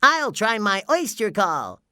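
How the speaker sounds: high-pitched, determined character voice; SpongeBob. A narrator's reading. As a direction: declare it eagerly in a high cartoon voice. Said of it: "said SpongeBob".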